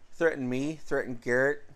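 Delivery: normal talking voice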